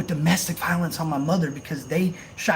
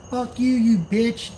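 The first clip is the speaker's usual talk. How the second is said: higher, drawn-out cussing-out; mock angry outburst; 7 semitones higher, 3 dB louder, slower than usual. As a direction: cuss them out slowly, pitched up. Acting out his own outburst at a lady.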